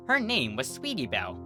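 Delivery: ordinary delivery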